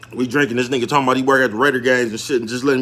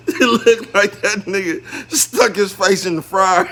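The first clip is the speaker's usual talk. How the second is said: laughing; 4 semitones higher, slower than usual. Real, not acted. Laughing at greasy face.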